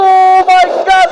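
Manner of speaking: drawn-out hype exclamation; excited. Real, not acted. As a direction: stretch it out in shock. Caster hype at team fight.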